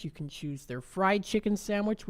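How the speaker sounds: normal talking voice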